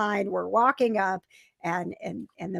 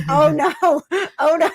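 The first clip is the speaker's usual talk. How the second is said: higher, louder worried exclamation; mock alarm; 5 semitones higher, 6 dB louder than usual. Watching a friend about to keel over, mock alarm. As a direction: retell dread with a laugh in it.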